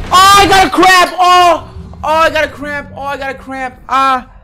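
high pained yelling, fading; screaming in pain. Real, not acted. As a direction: cry out through a cramp. Real cramp, shouting in pain.

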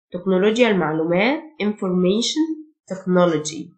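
ordinary talk, nothing marked

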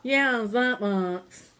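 slow, drawn-out mimic of the kid; mocking Riley. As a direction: stretch it out, teasing her voice. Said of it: "I don't sound like that".

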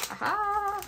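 sudden high delighted aha; triumphant aha. Real, not acted. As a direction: pounce on the find with a gasp of joy. Finds the card she wanted.

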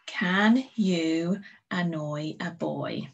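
very slow read-along; teacher reading along with pupils, slowly; slow choral read. Shown as a demonstration. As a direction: read it slowly together with the class. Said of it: "Now you say it with me".

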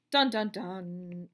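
slow, low ominous dun dun dun; mock-dramatic musical drumroll; dramatic sting. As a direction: chant it like a suspense cue in a movie. Dramatic suspense sting before topic.

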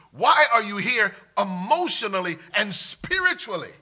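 loud, high preaching voice; impassioned pulpit challenge, building in force; God challenging Elijah. Acted. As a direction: confront him with pressing questions. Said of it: "This is saying".

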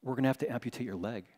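low, soft, grave news; somber doctor. Retold or imitated. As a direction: deliver it like a solemn doctor, quickly. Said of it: "really somber".

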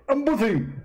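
high-pitched mock debater voice; a squeaky, smug rival preacher; Zakir Naik impression. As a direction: push the voice way up and lampoon him. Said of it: "Uh, Zakir".

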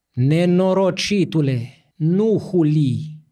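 slow, loud stern priest cutting him off; slow and weighty, a touch of a stern bishop; stern cleric's slow rebuke. A narrator's reading. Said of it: "îl curmă mitropolitul".

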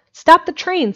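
harsh shout to the baggage master; harsh command. A narrator's reading. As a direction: bark it to halt the train. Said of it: "said Abner Sawyer harshly".

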